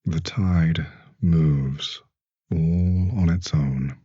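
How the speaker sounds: slow, soothing guided meditation with long pauses; sleep hypnosis. Acted. Hypnotist easing a wakeful listener back toward sleep, calm and unhurried. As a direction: lull them gently, phrase by unhurried phrase.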